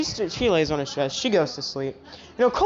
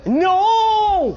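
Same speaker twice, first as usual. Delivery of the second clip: loud, high, drawn-out no; real scolding shout; 12 semitones higher, 5 dB louder than usual. Real, not acted. Stopping someone touching that, real loud shout. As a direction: shout to stop them, pitch leaping up.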